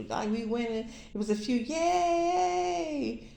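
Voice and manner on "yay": high, squeaky cheering crowd voice; chirpy little cheerleader squeal; cheering angels. Retold or imitated. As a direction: cheer her name like a tiny adoring crowd.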